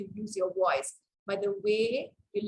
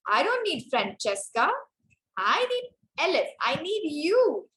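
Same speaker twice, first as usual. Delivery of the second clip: "Francesca", "Elif" and "you" punched higher as a contrast; stress on who is needed; 3 semitones higher, 3 dB louder than usual. Shown as a demonstration. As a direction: lean on each name, then a long raised "you". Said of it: "that's how you stress on different words".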